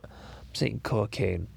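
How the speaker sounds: cocaine said slowly and carefully, syllable by syllable; slow careful pronunciation. Pretend. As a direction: drag out cocaine to get it right. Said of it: "I can't even say the cor".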